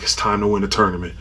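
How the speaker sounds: quiet, worn-out self pep talk; exhausted pep talk. Retold or imitated. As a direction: hype yourself up with no energy left. Worn out, half-hearted pep talk.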